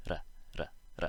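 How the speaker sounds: short tapped R in each "ra"; "ra" with a single Spanish-style tapped R; tap sound demo. Shown as a demonstration. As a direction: flick the tongue once for "ra", with gaps between. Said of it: "It is like the Spanish R".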